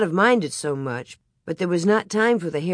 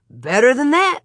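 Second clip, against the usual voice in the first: louder, higher gleeful hint; excited teasing; 7 semitones higher, 6 dB louder than usual. A narrator's reading. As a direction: build up the surprise. Pa teases with a surprise.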